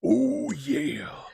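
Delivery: loud hyped wrestling promo. Pretend. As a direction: growl it like a pro wrestler. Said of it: "I'll do it in my wrestling voice".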